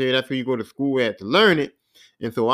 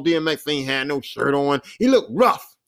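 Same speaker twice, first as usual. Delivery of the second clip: usual speaking voice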